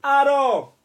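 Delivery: high, loud call of Arrow; calling horse. Retold or imitated. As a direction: bellow Arrow like the game hero. Imitating the game character calling.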